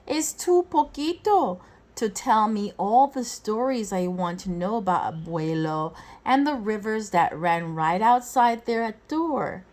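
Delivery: usual speaking voice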